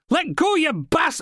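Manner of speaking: high, drawn-out shout; struggling yell. A narrator's reading. Officer yanked off balance to attacker, struggling yell. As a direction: yell to break free of a hold.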